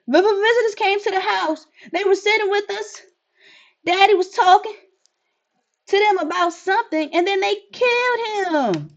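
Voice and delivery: put-on high child voice, slowing at the end; small child. Child explaining the visitors killed her father, high and shaken. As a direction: tell it like a shaken little kid.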